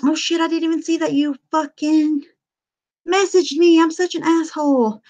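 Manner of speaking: high, surprised oh shit; a sudden gasp of realising she missed it; startled self-reproach. Real, not acted. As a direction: jump up in pitch, caught off guard. Suddenly notices missed message.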